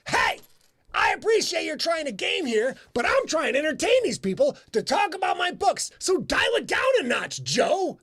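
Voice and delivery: loud, high call across the room; yelling at gamers. Retold or imitated. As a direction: shout them down from the podium. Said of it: "I had to yell at fifty plus gamers".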